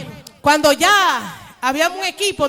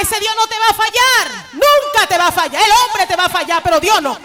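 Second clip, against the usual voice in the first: fervent preaching; 3 semitones higher, 5 dB louder than usual. Acted. Pastor assuring the congregation God never fails, fervent preaching. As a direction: proclaim it to the church with fire.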